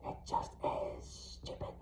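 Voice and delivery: slow unvoiced whisper; ghostly slow whisper. A narrator's reading. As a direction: hiss it softly from the shadows. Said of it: "Her whisper lingered".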